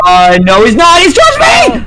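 panicked yelling. Real, not acted. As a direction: cry out while being chased. Monster chasing him.

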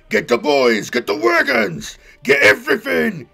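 very loud orc bellow; rally the warband to battle; yelled orders. Acted. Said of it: "he yelled at Gorag".

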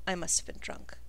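softly muttered complaint; muttering. Acted. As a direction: mutter it to yourself. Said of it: "and she mutters".

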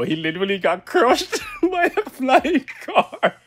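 laughing through the words. Real, not acted. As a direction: still giggling while telling it. Still laughing about crash.

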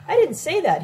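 emphatic narrated denial; insistent. A narrator's reading. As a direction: insist firmly, pitch jumping up. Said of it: "he insisted".

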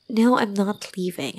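ordinary delivery

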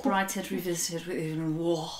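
lightly posh title read; a hint of posh. Shown as a demonstration. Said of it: "posh voice".

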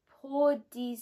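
slow, badly pronounced French; halting attempted French. Real, not acted. Vlogger reading French off foot cream label, halting attempted French. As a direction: sound out French word by word, unsure. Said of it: "I think this is French".

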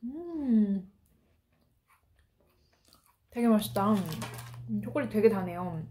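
talking while eating cookie; chewing with lips closed; mouth full, chewing. Real, not acted. Seen 1: bites cookie at 1.4, visibly chewing while speaking through 8.5.

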